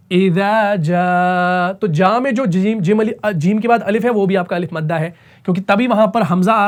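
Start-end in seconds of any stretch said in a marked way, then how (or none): 0.1-1.7 इज़ा जा with the aa of जा held very long; elongated madd; drawn-out recitation. Shown as a demonstration. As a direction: stretch the madd in इज़ा जा slowly. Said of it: "तो हम पढ़ते हैं इज़ा".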